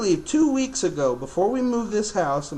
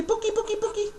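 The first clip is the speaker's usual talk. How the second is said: much higher, sing-song Pookie Pookie for the bird to copy; high bird-teaching voice; 4 dB quieter, faster than usual. Shown as a demonstration. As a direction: coax the parrot to repeat it, way up high.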